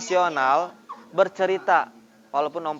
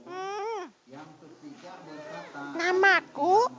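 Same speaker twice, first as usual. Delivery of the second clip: much higher tiny voice, squeaky; small voice; 12 semitones higher, 5 dB quieter, much slower than usual. Shown as a demonstration. Teacher tries the small voice for children, very high. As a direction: pitch it way up like a little kid. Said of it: "suaranya seder hidung".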